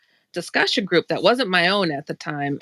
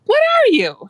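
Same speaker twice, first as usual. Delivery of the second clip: sudden high, louder startled question; startled cry; 5 dB louder than usual. Retold or imitated. To a towering alien, a startled high cry. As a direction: blurt it out in shock at the giant being. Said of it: "And I'm like".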